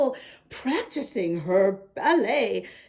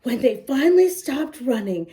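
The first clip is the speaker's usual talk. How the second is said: ordinary delivery, nothing marked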